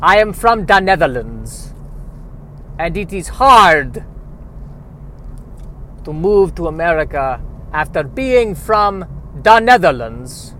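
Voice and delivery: put-on loud, heavily overdone Dutch accent; bad Dutch accent. Pretend immigrant to America, hammy Dutch accent. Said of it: "lean hard into this bad accent".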